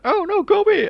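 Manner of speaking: high, loud flustered voice; high mock shriek. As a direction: shoo them off in a high panic. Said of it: "the sheriff's trying to get into the rooms".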